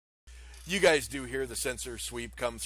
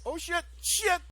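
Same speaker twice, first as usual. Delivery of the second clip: startled curse; 4 dB louder than usual. Acted. Pilot sees river suddenly.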